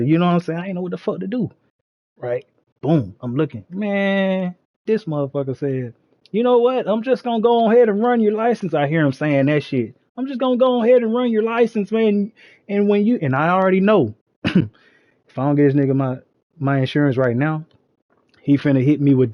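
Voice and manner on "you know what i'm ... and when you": mock cop voice. Cop deciding to run license.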